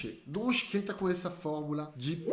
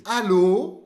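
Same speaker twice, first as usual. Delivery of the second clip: "Allô" with a loud, lifted last syllable; stress on final syllable; 9 dB louder than usual. Shown as a demonstration. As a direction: lean hard on the end of "Allô". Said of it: "a sílaba tônica fica no final".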